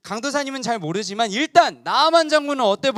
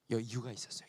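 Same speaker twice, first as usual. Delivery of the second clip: 속삭임; 12 semitones lower, 20 dB quieter, faster than usual. Shown as a demonstration.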